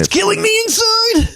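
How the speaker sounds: very high, slow fake wail; mock tearful breakdown. Grown man secretly suffering, mock-crying wail. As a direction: wail it as if falling apart. Adult's inner breakdown, acted.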